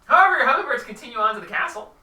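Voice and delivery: loud, high, quick narration; brisk announcement. Real, not acted. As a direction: cut in brightly and push the story on. Game master briskly resumes narration.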